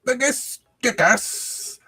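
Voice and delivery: very high, slow mock Roman voice; high drawn-out Python goof; Monty Python impression. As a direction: stretch it out high and silly. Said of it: "he's like".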